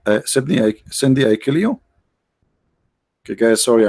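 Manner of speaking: ordinary delivery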